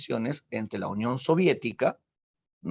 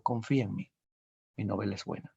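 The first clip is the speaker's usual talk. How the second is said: softer and lower, comforting; calm her down gently; soft reassurance; 5 semitones lower, 6 dB quieter than usual. Acted. Husband comforting his worried wife, soft reassurance.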